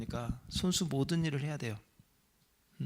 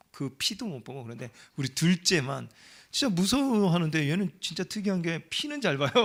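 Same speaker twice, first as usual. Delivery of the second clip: normal talking voice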